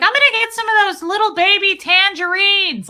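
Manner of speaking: imitating a buzzed mom's high, loud yell; tipsy yelling. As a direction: holler it high across the store, tipsily. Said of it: "she starts yelling".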